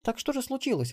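put-on high-pitched girl's voice; granddaughter murmuring. A narrator's reading. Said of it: "пробормотала внучка". (высокий голос внучки, вопрос вполголоса)

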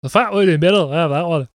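loud, high, put-on Scottish accent. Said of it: "I can do Scottish voice".

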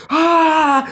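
short high yelled "ah" like a Resident Evil monster; monster scream. Retold or imitated. Retelling a schoolyard fight, imitating the kid's monster scream. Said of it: "Gritando assim".

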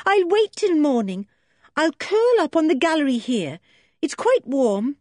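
put-on a frightened girl desperate to help; girl pleading through the door; distressed young girl. Joe voiced.

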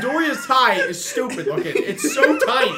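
high, indignant griping through laughter; comic rant. Real, not acted. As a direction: get absurdly worked up, laughing. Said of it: "rant over".

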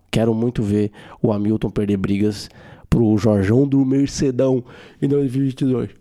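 ordinary delivery

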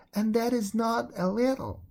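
put-on a frightened girl pouring out her guilt; pleading servant girl. Servant girl's voice.

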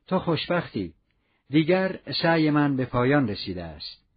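usual speaking voice

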